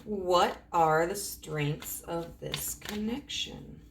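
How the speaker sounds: slow, spaced-out question. Real, not acted. Reader asking about the connection's strengths, slow and deliberate. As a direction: draw the question out word by word.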